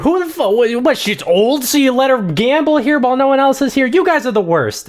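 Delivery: high-pitched indignant rant; Anderson protesting. Acted. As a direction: rail at them while being shoved out. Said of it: "Anderson is saying as he's, like, being shoved out".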